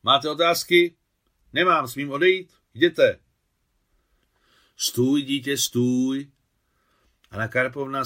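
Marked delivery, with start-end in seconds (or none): 0.0-3.2 loud, high, formal questions and answers; brisk orders at raised volume; military exchange. A narrator's reading. Military exchange, officer and courier. 4.8-6.3 loud, slightly raised-pitch call; insistent motherly call; calling him back. A narrator's reading. Older woman calls soldier back.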